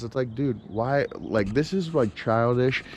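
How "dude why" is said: normal talking voice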